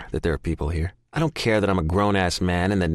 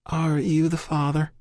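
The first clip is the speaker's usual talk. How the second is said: gentle Southern nurse, voice pitched higher; soft Southern accent; 10 semitones higher than usual. A narrator's reading. Nurse asks the waiting man, soft Southern accent. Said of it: "she asks again".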